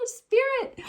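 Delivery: a high, sung-out name; light up at the card; delighted. Real, not acted. Reader spotting a card she loves: high delighted call.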